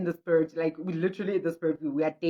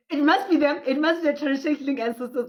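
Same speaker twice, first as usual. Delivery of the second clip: excited realization; 6 semitones higher, 3 dB louder, faster than usual. Retold or imitated. Chemist's realization acted out.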